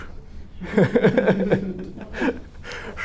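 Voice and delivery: high breathless laugh; embarrassed laugh. Real, not acted. Telling the class about complaining, breathy laugh. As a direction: burst into airy giggles at yourself.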